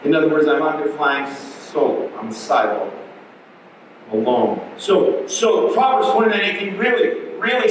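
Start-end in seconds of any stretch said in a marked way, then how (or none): none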